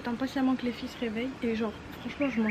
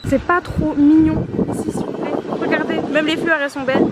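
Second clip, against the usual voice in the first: excited; 8 semitones higher, 10 dB louder than usual. Real, not acted. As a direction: swoon over how pretty it is. Gushing over the flowers.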